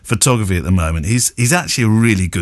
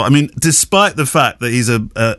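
ordinary talk

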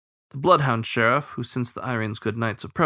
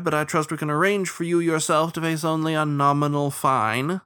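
higher-pitched, formal lawman voice; officious starling sheriff; 6 semitones higher than usual. Acted. Starling offering the captain leniency, officious. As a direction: lay out the deal officiously.

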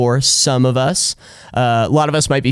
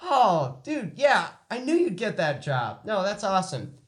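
excited phone chat; 10 semitones higher, 11 dB quieter than usual. Acted. Friendly phone call.

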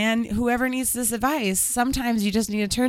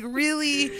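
ordinary talk

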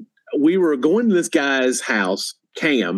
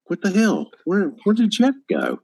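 higher-pitched, puzzled party guests; acted bafflement; 7 semitones higher than usual. Guests wondering where Jeff went, acted bafflement. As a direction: imitate guests baffled by a sudden exit.

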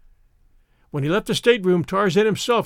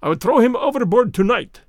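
raised, higher-pitched angry cry; fuming; 4 semitones higher, 4 dB louder than usual. A narrator's reading. Rokoff threatens revenge to his accomplice in the stateroom, fuming. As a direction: cry it out in a fume. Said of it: "he fumed and swore".

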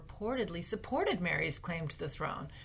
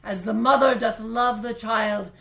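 big period-drama voice; 3 semitones higher, 11 dB louder than usual. Pretend. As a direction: declaim like a queen rallying her subjects. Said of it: "I'm gonna try to make a big voice like they do in period dramas".

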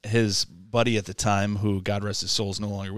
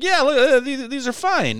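very high, loud mock voice; high squirrely buddy brushing off the dad; 5 dB louder than usual. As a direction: squeak out the buddy's dismissive reassurance. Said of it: "he's like".

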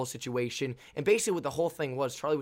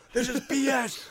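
high, strained protest; high-pitched heckle; yelling at the ref; 10 semitones higher, slower than usual. Retold or imitated. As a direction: call out the referee in a raised voice. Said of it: "he starts yelling at the head ref".

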